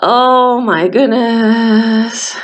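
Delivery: very slow drawn-out oh my goodness; amazed exclamation. Acted. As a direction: stretch it out in wonder.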